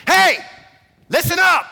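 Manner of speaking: loud, high attention-grabbing shout; bellowing angel. Acted. Angels calling out to shepherds on a hillside, bellowing. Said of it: "bellowing out this noise".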